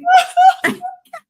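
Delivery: wheezing squeal of laughter; helpless high shriek laugh. Real, not acted.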